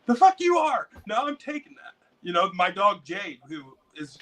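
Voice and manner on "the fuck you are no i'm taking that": high mock retort; mock argument. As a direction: toss back a cheeky high comeback.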